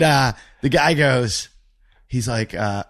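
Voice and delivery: usual speaking voice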